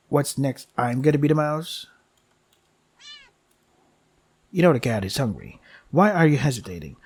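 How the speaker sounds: steady storyteller reading of the game text; game story narration. Acted. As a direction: narrate it like a dark fable.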